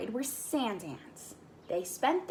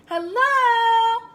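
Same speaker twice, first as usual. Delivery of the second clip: high, loud called hello; cry out; 11 semitones higher, 11 dB louder than usual. Acted. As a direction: call across the water. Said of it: "he cried to the frogs".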